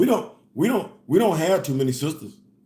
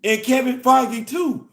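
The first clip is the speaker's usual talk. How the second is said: grandma warning; 8 semitones higher, 3 dB louder, slower than usual. Retold or imitated. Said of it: "And she said".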